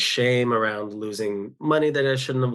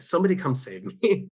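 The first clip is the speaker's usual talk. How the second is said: half-joking high cry for help, pitched up; mock plea for rescue; 6 semitones higher than usual. Texting friends, half-joking, a high mock plea for rescue. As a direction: play it as a light, self-mocking plea. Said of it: "sort of hinting like".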